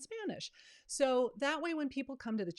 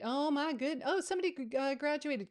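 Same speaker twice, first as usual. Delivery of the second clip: much higher, bright burst of real delight; light up at the good news; excited; 7 semitones higher, 4 dB louder than usual. Real, not acted. Host spots a viewer's graduation in chat, excited.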